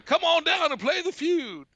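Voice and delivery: high-pitched host's catchphrase; game show slogan. Retold or imitated. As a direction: sing out the catchphrase like a TV host. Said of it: "'Cause that was his slogan".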